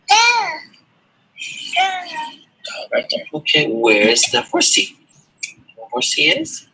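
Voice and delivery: put-on sing-song picture-book voice for a toddler; reading to a toddler. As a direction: coax a little child through the pictures. Parent shows toddler picture book.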